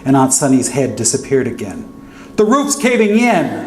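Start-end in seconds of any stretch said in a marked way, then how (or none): 2.4-3.7 very high, slow panicked scream. Retold or imitated. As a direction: scream the warning in terror. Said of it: "I screamed".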